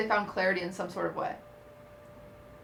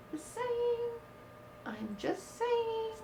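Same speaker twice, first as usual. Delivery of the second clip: teasing singsong; 12 semitones higher, 11 dB quieter, much slower than usual. Real, not acted. Teasing after accusation.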